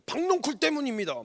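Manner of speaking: high, cheeky talking-back voice; defiant Jonah. Retold or imitated. Jonah talking back to God, high and defiant. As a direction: sass God like a sulking prophet.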